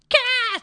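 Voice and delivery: high-pitched playful horror shriek; mock scream. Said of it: "悲鳴みたいにしてやってたんですよ".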